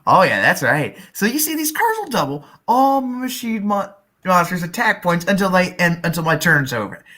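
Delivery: ordinary talk, nothing marked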